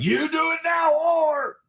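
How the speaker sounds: high-pitched mock shout of a stern father; stern dad. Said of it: "dad would be stern".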